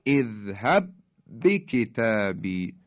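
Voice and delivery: اذهب به کتابی chanted with one doubled ب, first ب not sounded; merged recitation. Shown as a demonstration. Teacher demonstrating idgham to students, one stressed ب. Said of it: "دیگه حرف اول رو تلفظ نمی کنیم".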